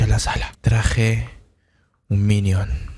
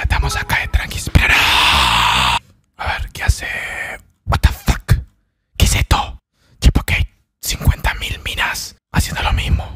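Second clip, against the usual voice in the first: unvoiced whisper throughout; ASMR whisper; 7 semitones lower than usual. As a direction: whisper chat close to mic.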